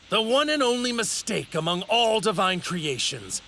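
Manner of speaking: high, fervent villain oratory; impassioned condemnation. Acted. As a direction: condemn mortals like a zealous god. Villain's impassioned speech against mortals.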